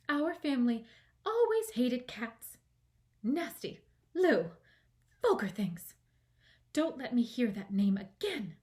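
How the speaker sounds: loud, high, disgusted outburst; indignant rant. A narrator's reading. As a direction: spit out the hated word. Offended mouse ranting about cats.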